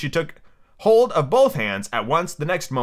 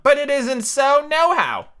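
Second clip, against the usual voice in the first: high, slow, emphatic denial; high-pitched and deliberate; contrary Tweedledum; 12 semitones higher, 4 dB louder, slower than usual. Acted. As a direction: contradict in a high storybook-twin voice. Tweedledum speaking, acted.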